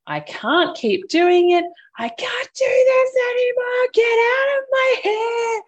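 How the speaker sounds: rising to a high, loud, slowed yell; frazzled mother yelling at the kids to leave her room; escalating yell. Retold or imitated. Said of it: "It escalated very quickly".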